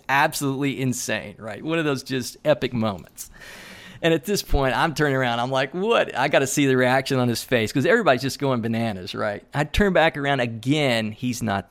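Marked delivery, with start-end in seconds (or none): 5.7-6.1 surprised. Retold or imitated. Sees the light break.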